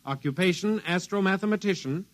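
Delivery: measured official announcer read; radio broadcast read. Acted. Radio man reads missing persons bulletin to agencies, official announcer read. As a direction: call it out like a police dispatcher on air.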